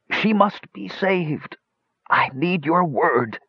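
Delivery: high, slow, strangled cry; strangled exclamation. A narrator's reading. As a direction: force each word out through a tight throat. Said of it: "In a strangled voice, he exclaimed".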